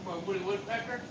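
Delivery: normal talking voice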